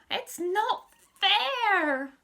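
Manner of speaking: slow, high, loud wail. Acted. Henry protests being sent to his room, slow wail. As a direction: drag it out in a childish wail. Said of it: "wailed Henry".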